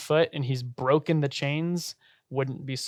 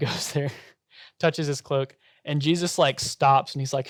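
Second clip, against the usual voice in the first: ordinary delivery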